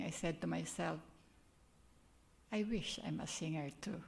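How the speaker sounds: ordinary talk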